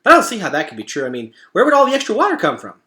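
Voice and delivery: rapid-fire skeptical chatter; Chit Chat, doubtful. Acted. Chit Chat doubts rising ocean.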